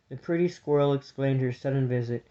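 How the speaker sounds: plain reading voice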